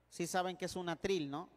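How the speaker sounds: ordinary talk, nothing marked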